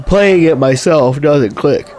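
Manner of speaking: talking through a yawn, slow. Real, not acted. Streamer explaining why Doom doesn't click for them, yawning. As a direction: stretch the words out through a real yawn. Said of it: "I don't know why I'm yawning".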